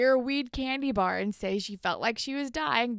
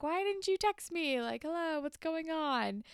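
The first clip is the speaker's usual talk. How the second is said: put-on higher, pouty whine of a clingy girlfriend; mock whiny girlfriend; 7 semitones higher than usual. Girlfriend nagging Spinner for not texting, higher mock whine. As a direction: play the needy girlfriend, half-joking.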